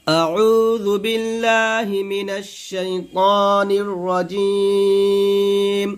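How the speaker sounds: slow, drawn-out melodic recitation; Quran recitation. Acted. Teacher opens the Quran lesson, slow melodic recitation. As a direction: intone it slowly like tilawat.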